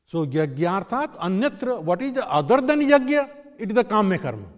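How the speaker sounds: high, loud emphatic sermon; loud preaching. Real, not acted. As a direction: raise the voice and preach. Preacher raising voice.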